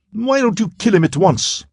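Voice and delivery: high, sharp female voice, impatient; a woman demanding it now; impatient cry. A narrator's reading. Narala urges Quarren to kill the prisoner, high and impatient. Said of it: "Now she cried impatiently".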